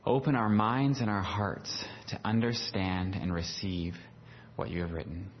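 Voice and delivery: soft, hushed prayer to God; quiet prayer. Real, not acted. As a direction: pray quietly and reverently. Pastor prays before the sermon.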